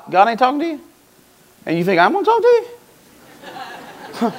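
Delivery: rising, high-pitched disbelief; incredulous. Acted. Samuel answering Saul whom God ignores, incredulous. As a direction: question it in mock disbelief, climbing at the end.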